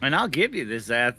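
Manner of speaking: ordinary talk, nothing marked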